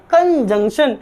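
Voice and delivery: "कंजंक्शन" as kan plus junction, rising; correct pronunciation. Shown as a demonstration. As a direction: join kan to jungshan in "कंजंक्शन". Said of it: "कैसे बोलेंगे?". ("कंजंक्शन" में कन और जंक्शन)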